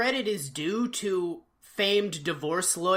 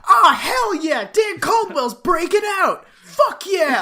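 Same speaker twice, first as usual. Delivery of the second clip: loud, high mock-kid cheering; hammed-up yell of pure excitement; gleeful yell; 10 semitones higher, 8 dB louder than usual. Host imitating a kid cheering on a jailbreak, gleeful yell. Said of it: "he yells like".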